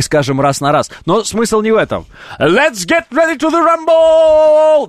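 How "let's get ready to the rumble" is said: drawn-out high announcer bellow; boxing ring announcer. Pretend. (ринг-анонсер, протяжно и громко)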